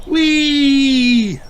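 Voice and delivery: high happy whee; gleeful call. Acted. Children racing downhill, squealing. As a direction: squeal going down hills. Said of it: "they call".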